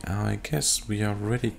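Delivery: normal talking voice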